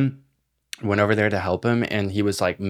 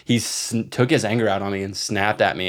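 normal talking voice

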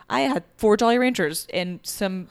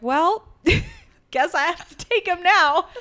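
laughing; 12 semitones higher, 8 dB louder, slower than usual. Retold or imitated. Deciding to adopt the cat.

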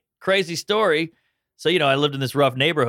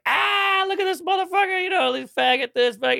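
much higher, mocking shout; jeering taunt. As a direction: jeer at him like rowdy teenagers with a much higher voice. Teen bullies jeering at a man.

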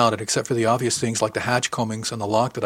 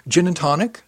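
higher, hopeful question; 7 semitones higher, 5 dB louder than usual. A narrator's reading. Asking uncle for a drink, hopeful. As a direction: ask it brightly, hoping for a yes. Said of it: "I asked hopefully".

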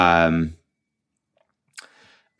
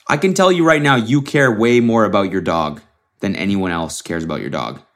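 ordinary talk

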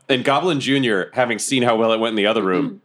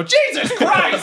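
high, drawn-out cry of shock; shocked exclamation; much slower than usual. Acted. Player opening the door onto the carnage, hammed-up shocked yelp. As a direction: exclaim in horror, half laughing.

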